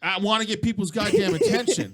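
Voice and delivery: loud, high mock attention-grabbing yell; all-caps shouting. Host plays an all-caps post, mock shouting. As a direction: shout it like a post typed in capitals. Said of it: "It's like talking like this".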